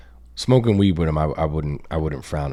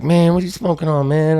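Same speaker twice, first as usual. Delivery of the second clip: higher-pitched begging addict act; crackhead impression; 7 semitones higher than usual. Child imitating mom wanting weed, crackhead manner. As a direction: lightly play a pestering crackhead. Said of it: "crackhead, like, interaction".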